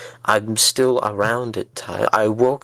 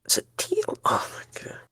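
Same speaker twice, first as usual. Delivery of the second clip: breathless, stunned whisper; can't believe the betrayal, hushed; shocked whisper; 7 dB quieter than usual. Acted. To the friend who made a deal, stunned whisper.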